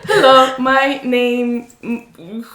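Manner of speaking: put-on loud, slow, halting Spanish-accented English; beginner English accent. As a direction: mimic a learner stuck mid-introduction, Spanish accent. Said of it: "And the girl's like".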